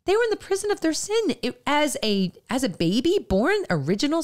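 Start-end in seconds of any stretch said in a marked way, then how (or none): 0.1-1.4 much higher and fast, pressing the point; emphatic repeat. Real, not acted. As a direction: lift it urgently, driving the point home.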